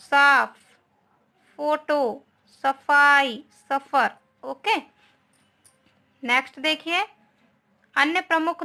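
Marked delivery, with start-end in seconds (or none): 0.1-4.1 साफ and सफाई with a loud, clear फ; teacher drilling the foreign फ sound; dotted फ demo. Shown as a demonstration. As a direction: punch the फ in साफ, फोटो, सफाई, सफर. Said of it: "इनका उच्चारण करना सीखिए".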